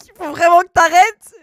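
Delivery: high, loud playful scolding; mock exasperated yell. Streamer tells a chatter to stop, mock exasperated yell.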